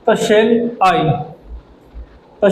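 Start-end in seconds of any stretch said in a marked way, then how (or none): 0.2-1.0 "shall I" with "shall" weak and "I" stressed. Shown as a demonstration. Teacher contrasting weak "shall" with stressed "I", demonstrative. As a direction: keep "shall" light and lean on "I". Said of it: "shall से थोड़ा सा ज़्यादा जोर किस पे, I पे".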